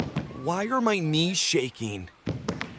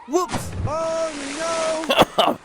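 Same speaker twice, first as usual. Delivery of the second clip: startled yelp; 9 semitones higher, much slower than usual. Acted. Bean slipping in the pool, yelping in surprise. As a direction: pitch jumps up high on a sudden slip. Trying to act cool, slips.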